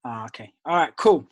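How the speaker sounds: normal talking voice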